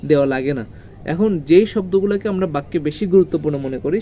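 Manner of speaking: normal talking voice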